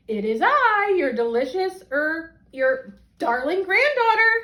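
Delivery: wolf at Granny's door in a put-on high voice. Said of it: "said the wolf in a high voice".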